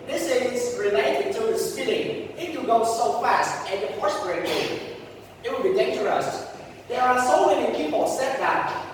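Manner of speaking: ordinary delivery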